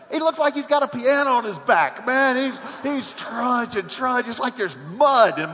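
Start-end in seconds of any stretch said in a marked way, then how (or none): none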